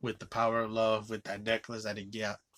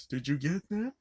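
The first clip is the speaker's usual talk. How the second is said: lightly surprised, higher-pitched question; mock surprise; 5 semitones higher, 3 dB louder than usual. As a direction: imitate a startled news anchor. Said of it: "it surprised him".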